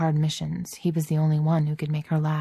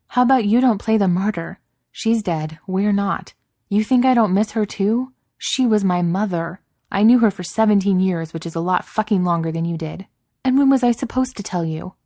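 higher and louder, furious and hurt; angry outburst; 4 semitones higher, 6 dB louder than usual. Acted. Tony yelling at Steve over her dead mother, furious. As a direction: throw it back at him, rising to a near shout.

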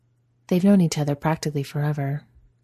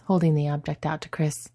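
ordinary delivery

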